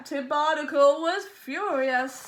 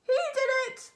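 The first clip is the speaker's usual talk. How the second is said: parrot squawk; 11 semitones higher than usual. A narrator's reading. Parrot refrain repeated.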